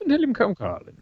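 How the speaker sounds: half-sure impression of a broadcaster; Wogan. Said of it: "do King Willie's lines in Wogan's voice".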